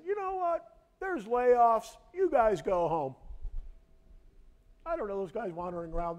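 high and loud, a boss God sending his people home; mock God voice. Pastor mocking God laying off his people. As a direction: play God as a careless boss laying off workers.

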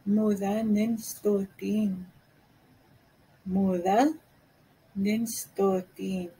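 slow careful teacher repetition; slow, split in two. Shown as a demonstration. Cree teacher slowly modelling the phrase for learners. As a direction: say Mula, pause, then ninstotin slowly. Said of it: "I don't understand".

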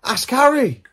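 high, loud, exasperated suggestion; emphatic suggestion. Real, not acted. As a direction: insist on Harry.

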